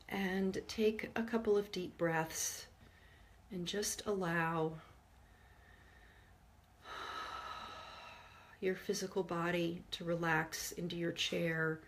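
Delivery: soft meditation voice with long pauses; guided meditation. Real, not acted. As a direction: guide listeners gently inward, hushed and unhurried. Leads a forgiveness meditation.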